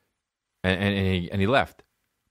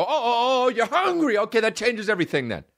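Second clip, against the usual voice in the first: mock realization; 10 semitones higher than usual. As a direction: exaggerate the dawning understanding, pitched way up. Acted sarcastic sudden understanding.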